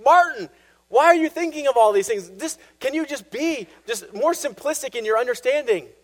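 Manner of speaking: worn-out priest begging Martin to stop; exasperated father. Retold or imitated. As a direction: confessor at the end of his patience. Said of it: "wear down the fathers".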